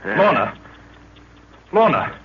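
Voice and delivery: urgent worried call of her name; anxious calling. Acted. Jack calling to fainted Lorna, worried.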